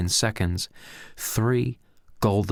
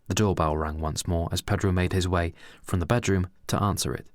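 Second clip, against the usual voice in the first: ordinary delivery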